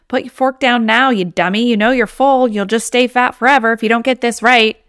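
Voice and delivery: loud, scolding and belittling; harsh inner critic. Acted. Inner critic berating you at the table, loud and harsh. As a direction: bark it like a mean mother-in-law bossing you around. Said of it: "I don't wanna hear anything like".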